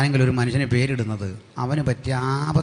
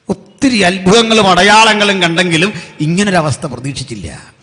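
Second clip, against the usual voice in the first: raised, higher preaching cry; impassioned preaching; 7 semitones higher, 10 dB louder than usual. Acted. Preacher tells of an unexpected crisis at the sea, impassioned. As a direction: burst out louder and higher. Preacher dramatizes Israel's sudden plight.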